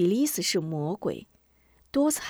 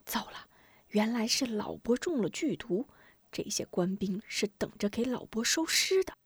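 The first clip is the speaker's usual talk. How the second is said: hushed, softer private realisation; alarmed inner thought; 6 dB quieter than usual. Acted. Boy realises the old man is poisoned, hushed inner voice. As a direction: think it aloud under your breath. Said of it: "暗道".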